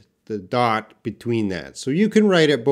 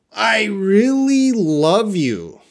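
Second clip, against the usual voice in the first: slow, high, drawn-out "really love"; emphatic; 8 semitones higher, 4 dB louder, slower than usual. Shown as a demonstration. Teacher reading an all-capitals example to class, emphatic and drawn out. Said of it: "They want to emphasize love".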